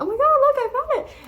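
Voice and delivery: high, loud reenacted squeal of triumph; excited discovery. Teller reenacting finding lost AirPods, excitedly squealed. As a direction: burst out high and loud with the find.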